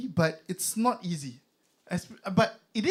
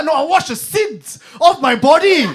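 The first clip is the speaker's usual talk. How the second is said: loud retort; 9 semitones higher, 13 dB louder than usual. Retold or imitated. Comedian's loud punchline retort.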